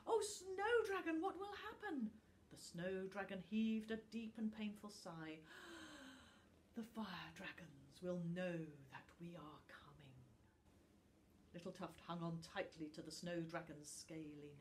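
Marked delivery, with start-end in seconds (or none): 0.1-2.1 high, pleading frightened voice; little Tuft begs the snow dragon; frightened child. Acted. 5.4-6.2 pained dragon sigh. Acted. 6.7-10.1 the snow dragon warns Tuft in a whisper; dragon's whisper. Acted.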